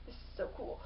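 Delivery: hushed, breathless wonder; awed whisper. Retold or imitated. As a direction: whisper it in quiet amazement. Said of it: "the one looking around going".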